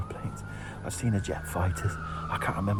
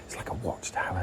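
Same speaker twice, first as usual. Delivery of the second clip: whispering. Real, not acted. Hiding beside guardhouse.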